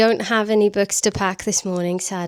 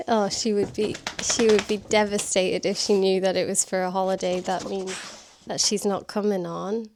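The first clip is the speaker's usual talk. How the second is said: ordinary talk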